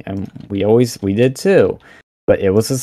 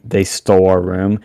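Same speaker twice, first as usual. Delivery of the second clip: ordinary delivery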